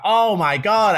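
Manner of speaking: high, loud retold gasp of delight; thrilled outburst. Telling of being paired with April Ashley, thrilled outburst. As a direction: relive the thrill, pitched right up.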